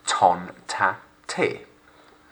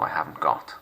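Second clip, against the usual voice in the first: usual speaking voice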